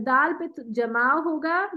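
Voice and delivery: ordinary talk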